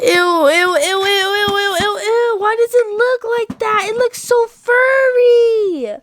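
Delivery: high, rising ew ew; squealing disgust. Acted. Disgusted at spider.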